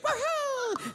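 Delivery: very high whoop of joy; bill cheering. Acted. Five dollar bill cheering with friends, high whoop. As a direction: whoop like a party.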